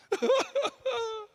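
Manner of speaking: high-pitched, put-on boo-hoo sobbing; mock crying. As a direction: fake a self-pitying wail. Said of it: "he began to cry".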